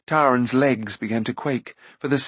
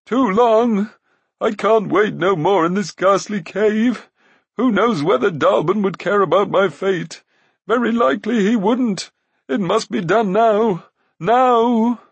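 high, loud wailing voice; moaning Glue; 9 semitones higher, 6 dB louder than usual. A narrator's reading. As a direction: moan in self-pity. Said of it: "moaned the voice of Glue".

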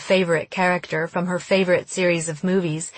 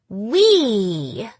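"We" leaned on hard and dragged out; stressed word; 3 semitones higher than usual. A narrator's reading. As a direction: squeeze out "We" like wringing a wet swimsuit. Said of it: "Marjorie stressed the word".

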